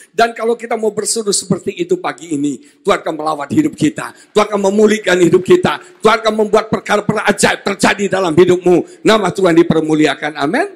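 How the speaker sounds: loud, driving proclamation; pressing, rousing sermon delivery; forceful preaching. Real, not acted. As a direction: declare promises over the congregation.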